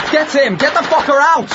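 high, loud urgent yell over gunfire; battlefield shout. Acted. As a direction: bellow the order across the roof. Firefight, shouted orders.

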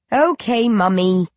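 put-on a small uncanny baby calling its mother. Baby agrees.

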